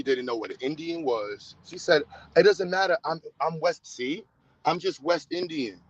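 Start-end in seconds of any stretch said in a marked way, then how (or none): none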